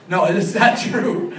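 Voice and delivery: slow, loud, laughing take-back; laughing denial. Real, not acted. Taking back the "too stupid" joke, laughing loudly. Jokingly takes back self-mockery.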